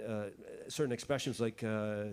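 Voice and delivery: normal talking voice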